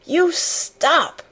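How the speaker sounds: high-pitched, angry near-shout; sharp cry just short of a shout; almost shouting. A narrator's reading. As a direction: order it to end, furiously. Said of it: "she almost shouted".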